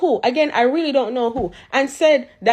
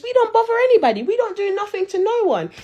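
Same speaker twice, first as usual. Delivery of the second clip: mock innocence; 9 semitones higher than usual. Real, not acted.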